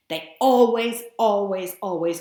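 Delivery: always punched loud, then easing off; insistent triple "always"; emphatic repetition. Shown as a demonstration. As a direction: hammer home "always" three times. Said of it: "teacher stresses the rule by repetition".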